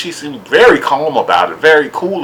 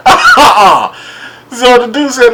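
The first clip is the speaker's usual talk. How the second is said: normal talking voice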